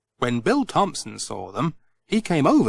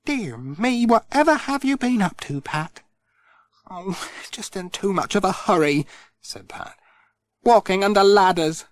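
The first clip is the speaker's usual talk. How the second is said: lightly raised motherly scolding; fussing Missus Thompson; 6 semitones higher than usual. Acted. Missus Thompson scolds Pat gently while binding his wrist. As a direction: tut over his wrist.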